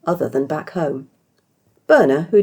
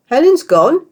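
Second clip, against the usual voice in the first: much higher, loud startled question; shocked stutter; 12 semitones higher, 10 dB louder, slower than usual. Acted. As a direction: caught off guard, sputtering. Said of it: "Beardy stuttered".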